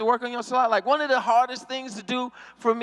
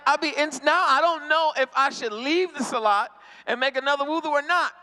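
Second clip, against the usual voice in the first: ordinary talk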